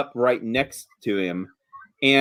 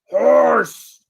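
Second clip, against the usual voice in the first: higher, louder giant cry of horse; giantess exclaims horse; 10 semitones higher, 4 dB louder than usual. Acted. Giantess warns the party about the dead horse, loud. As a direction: stress the word horse like a warning. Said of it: "She says".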